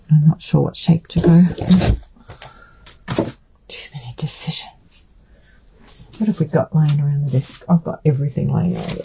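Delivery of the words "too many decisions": breathy whispered aside; whispering. Real, not acted. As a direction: whisper it to yourself.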